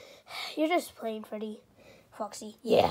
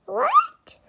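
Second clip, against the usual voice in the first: squeaky high chipmunk; 8 dB louder than usual. Acted. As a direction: pitch way up like a chipmunk. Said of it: "I sound like a little chipmunk".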